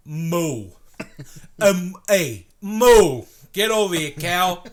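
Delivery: loud, high rough holler; loud high New York cow yell; New York cow call. Retold or imitated. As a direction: bark at the cow like a New Yorker. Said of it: "like, freaking New York".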